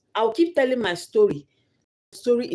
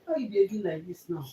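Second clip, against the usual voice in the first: quiet coaxing; 5 semitones higher, 6 dB quieter than usual. Real, not acted. Asking crying child what's wrong.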